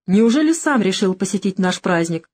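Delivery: plain reading voice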